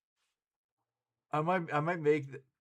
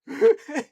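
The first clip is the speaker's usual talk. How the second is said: laughing; 11 semitones higher, 12 dB louder than usual. Real, not acted. Laughing at own verbal slip.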